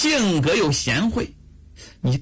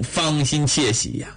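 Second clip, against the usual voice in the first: plain reading voice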